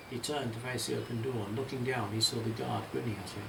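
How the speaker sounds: ordinary delivery, nothing marked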